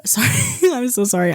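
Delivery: real giggly apology, starting high; embarrassed laugh at your own silly accent. Real, not acted. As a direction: apologize while breaking into laughter.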